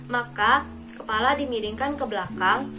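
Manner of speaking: ordinary delivery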